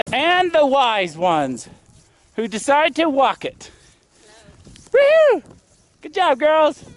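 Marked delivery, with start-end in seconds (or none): 4.9-5.4 loud, high whoop; cheering whoop. Real, not acted. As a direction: whoop them down the hill. Cheering the girls.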